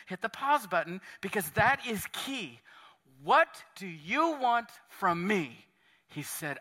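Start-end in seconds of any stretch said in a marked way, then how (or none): 3.2-5.7 "What do you want from me?" said slowly, leaning on "What"; slowed down. Shown as a demonstration. Pastor hitting pause on Jesus' question, slow deliberate "What do you want from me?". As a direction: drag each word of "What do you want from me?" out. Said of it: "I want to just slow down here for a second".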